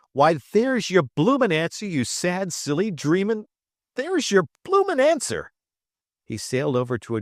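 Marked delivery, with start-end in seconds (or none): 0.1-5.5 radiant delight. Acted. Travis grins at Trip, gleeful and pitched up. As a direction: crow it out with glee. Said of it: "broke into a radiant grin".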